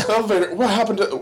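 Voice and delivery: fast, high-pitched mock alarm; high mock panic. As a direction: blurt it out in a squeaky panic. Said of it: "they're like".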